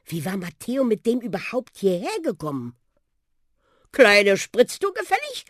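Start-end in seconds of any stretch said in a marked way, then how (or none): 3.9-5.4 high, loud talking bus; Buschi offering. Acted. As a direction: offer a ride with swagger. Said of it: "schlug der Buschi vor". (angeberischer Bus, einladend)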